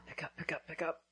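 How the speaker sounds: anxious whisper under the breath. Real, not acted. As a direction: whisper it, pleading for an answer. Anxious phone call, waiting.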